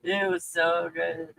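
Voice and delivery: dreamy food memory; savoring. Real, not acted. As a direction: linger on how good it was.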